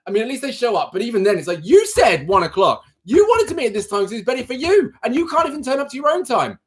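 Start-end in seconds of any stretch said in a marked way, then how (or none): 1.6-6.6 higher, exasperated rant at flaky clients; a real rant rising higher; frustrated rant. Real, not acted. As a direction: vent it, pitch climbing with frustration. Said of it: "I'm gonna start ranting".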